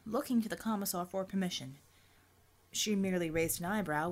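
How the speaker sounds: ordinary delivery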